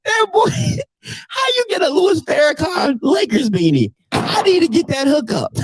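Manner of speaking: high, laughing incredulity; real helpless amusement; laughing disbelief. Real, not acted. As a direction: pitch it up, cracking up at him. Cracking up over the beanie.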